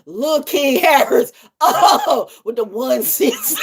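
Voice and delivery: high, slow, laughing words. Real, not acted. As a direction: barely get it out laughing. Laughing fit.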